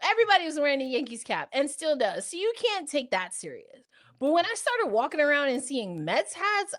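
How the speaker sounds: usual speaking voice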